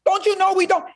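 high, exasperated parent voice; mock-frantic parents scolding a rebellious daughter. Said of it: "they're like".

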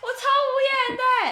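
exasperated. Real, not acted. Complaining about supervisor's favoritism.

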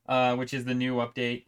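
usual speaking voice

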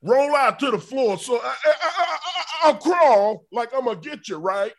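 high-pitched, hammed-up aggressive wrestler; Ron Simmons impression. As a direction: bark out the match plan like a mock-intense wrestler. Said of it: "that's my Ron Simmons impression".

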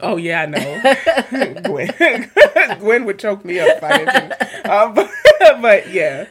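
words broken up by real laughter; laughing. Real, not acted. Teased that Gwen would insist on the PhD, laughing hard. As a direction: crack up while trying to talk. Cracking up at co-host's teasing.

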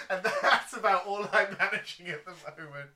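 loud laugh, words through laughter. Real, not acted. Laughing at own TV time.

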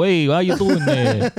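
high, loud, slow hype voice; mock Gary Dean. Answering whether Gary Dean talks like that, loud hype. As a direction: sell it like a cheesy guru. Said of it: "Does he talk like that".

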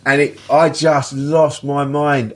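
ordinary talk

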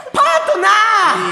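high, drawn-out catchphrase shout. Pretend. Radio performer cuts in on partner, big drawn-out shout. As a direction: bellow it like a tag-team call.

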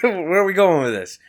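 mock disbelief; baffled laugh-line. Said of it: "Yeah, like".

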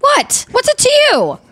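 high, mock-indignant comeback; a high, sassy What's it to you; defensive. Real, not acted. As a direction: fire it back, playfully defensive. Defensive about drinking water.

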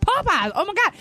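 high, thrilled airport squeal; excited squeal. Retold or imitated. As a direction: gush over finding Popeyes. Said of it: "I was like".

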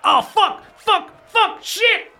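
high-pitched frantic cursing; panicked man. Acted. Game master as a man bursting in upstairs, high panicked cursing. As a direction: curse in a high, acted panic. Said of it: "you hear a man's voice go".

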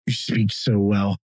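low-pitched imitation of a condescending admirer; mimicked compliment. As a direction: parrot the backhanded praise, dropping your pitch.

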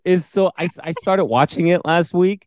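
very high, excited fangirl gush; gushing enthusiasm. Real, not acted. Telling co-host about the show she started last week, gushing enthusiasm.

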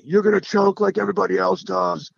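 gallery heckler mocking the leader; jeering heckler. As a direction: taunt him like a rowdy fan.

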